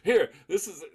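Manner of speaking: high, loud mock introduction through laughter; laughing imitation of girlfriend. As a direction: act out an eager show-off while cracking up. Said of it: "she was like".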